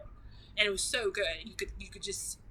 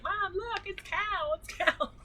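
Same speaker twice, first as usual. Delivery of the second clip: much higher, eager show-and-tell; excited to mom; 11 semitones higher, slower than usual. Retold or imitated. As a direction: chirp it at mom like showing a treat. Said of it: "So I'm always like".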